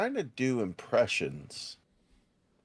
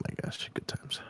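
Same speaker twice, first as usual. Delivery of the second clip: whispering; 10 dB quieter than usual. Real, not acted. Whispered aside alone on stream.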